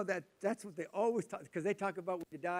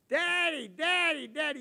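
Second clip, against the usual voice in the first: much higher, louder excited calls; kids calling out; 10 semitones higher, 9 dB louder, slower than usual. Retold or imitated. Kids running to their dad at the airport, excited and loud. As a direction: call out Daddy like thrilled kids.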